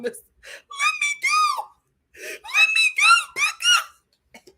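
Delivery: extremely high, slow, wailing sob; crying baby voice. Retold or imitated. Thomas sobbing at Becca to let him go, high baby voice. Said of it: "baby voice".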